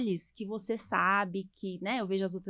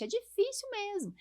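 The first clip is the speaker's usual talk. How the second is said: high, exasperated sigh of a line; high and fed up; 11 semitones higher than usual. Real, not acted. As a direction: really lean into how hard it is. Exasperated about confused parents.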